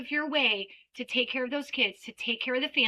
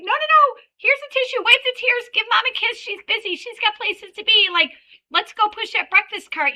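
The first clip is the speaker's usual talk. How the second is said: high, loud brisk cheerful instructions; upbeat teacher; 8 semitones higher, 7 dB louder than usual. Retold or imitated. Teacher to child leaving mom, high brisk cheer. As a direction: hustle the child along happily. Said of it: "And I'm like".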